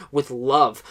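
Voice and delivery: normal talking voice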